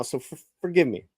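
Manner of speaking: ordinary delivery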